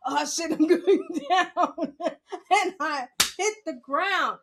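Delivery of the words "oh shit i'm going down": slower repeat, laughing through it; laughing panic. Retold or imitated. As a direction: drag it out while laughing. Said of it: "That's what I heard in my head".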